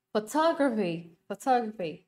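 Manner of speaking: "Photography" stressed on TOG, said twice; second-syllable stress. Shown as a demonstration. Teacher repeats the word for students, second-syllable stress. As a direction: lean on TOG in "Photography", falling tail. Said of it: "âm số hai luôn".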